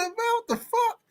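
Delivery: high, squeaky voice breaking into laughter; comic rising question, helpless with laughter; laughing disbelief. Retold or imitated. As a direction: replay your shock while cracking up. Retelling his shock while cracking up.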